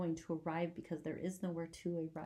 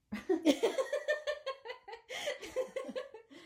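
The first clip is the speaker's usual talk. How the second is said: high, long laughter. Real, not acted. Mother laughs at joke.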